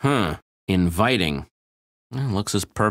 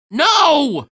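shout; 7 dB louder than usual. Acted. Sudden alarm.

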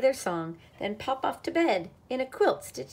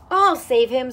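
high, loud urgent squeak; 4 semitones higher, 11 dB louder than usual. A narrator's reading. Squirrel rushing to rescue duck, squeaked. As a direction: squeak it out heroically. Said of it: "squeaked the squirrel".

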